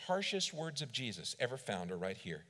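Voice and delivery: normal talking voice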